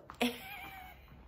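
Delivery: high-pitched laugh; real laugh. Real, not acted. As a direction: laugh in a high squeal at your own story. Laughs at her own story.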